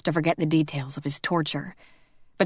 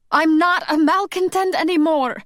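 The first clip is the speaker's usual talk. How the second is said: put-on high, loud shout in a thick accent; 11 semitones higher, 5 dB louder than usual. A narrator's reading. Marta shouting at Robbie in a thick accent. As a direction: cry it out, hurt and accented. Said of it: "Marta shouted with a thick accent".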